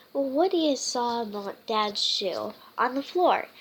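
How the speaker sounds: usual speaking voice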